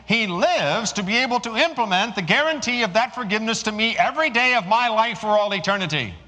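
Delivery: high, loud preaching at full force. Acted. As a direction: proclaim it to the whole congregation.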